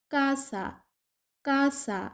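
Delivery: কাসা said with a hard k, like Bengali ক, carefully modelled; Spanish word casa. Shown as a demonstration. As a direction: model কাসা slowly with a crisp k sound. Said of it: "সে এর উচ্চারণ হবে ক এর মতো".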